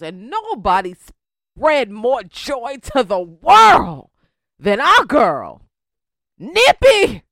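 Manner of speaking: building loud slow announcement; hype announcer. Real, not acted. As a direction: belt out the name like a ring announcer. Host hypes [name] intro.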